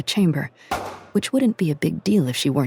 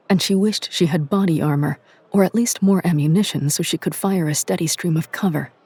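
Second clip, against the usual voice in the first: usual speaking voice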